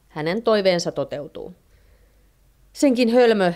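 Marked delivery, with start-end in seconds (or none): 2.7-3.5 higher, louder scolding; irritated outburst. A narrator's reading. Wife berates husband for a foolish wish, irritated outburst. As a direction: snap at him in narrated exasperation. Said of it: "hermostuu Heinoska".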